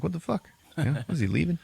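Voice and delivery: high-pitched baffled questions; mock bewilderment. Townspeople watching an ally ride off, mock bewilderment. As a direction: imitate stunned onlookers. Onlookers baffled as rider leaves.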